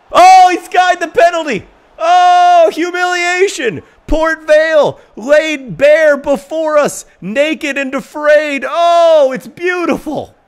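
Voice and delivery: high, shrieking over-the-top football commentary; mock commentator shout. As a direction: ham up the commentator as the penalty flies over. Mock commentator after missed penalty.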